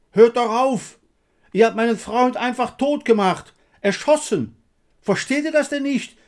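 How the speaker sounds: high frantic yelling, building to a scream; escalating, overwrought plea at full voice; desperate shouting. A narrator's reading. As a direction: cry out hysterically for it to stop. Breakdown under shelling, begging it stop.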